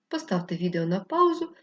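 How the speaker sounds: usual speaking voice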